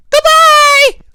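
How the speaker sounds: extremely high, loud cub call; piercing little squeak, called out; high squeaky voice. A narrator's reading. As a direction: squeal the farewell like a tiny bear. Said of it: "a high, squeaky voice call to her".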